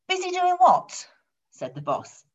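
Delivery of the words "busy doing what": high-pitched, irritated demand; a bit angry. A narrator's reading. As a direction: demand it like a boss losing patience. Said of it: "getting a little bit, uh, angry".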